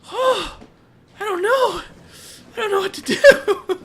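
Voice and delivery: high-pitched, loud, torn and half laughing; anguished high cry. Real, not acted. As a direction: cry out in helpless panic over the choice. Torn over killing Simon.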